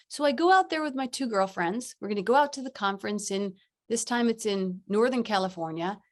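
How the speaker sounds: normal talking voice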